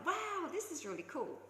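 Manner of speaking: high, thrilled exclamation; thrilled at the family coincidence; delighted. Retold or imitated. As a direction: gush with wonder. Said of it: "I was like".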